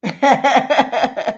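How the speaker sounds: giggling laugh. Real, not acted. Owning up to the habit, laughing. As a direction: laugh at how much you smoked. Confessing smoking habits, amused.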